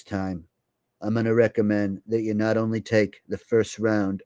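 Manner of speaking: measured, solemn appeal to the nation; politician impression. A streamer imitates a politician endorsing a booster, solemn impression. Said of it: "if you think that impression".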